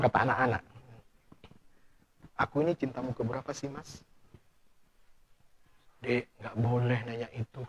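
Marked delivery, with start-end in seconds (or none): 2.3-4.0 hushed wife's question. Retold or imitated. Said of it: "wife asks about past loves". 6.0-7.6 hushed warning. Retold or imitated. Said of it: "kata Ustaz".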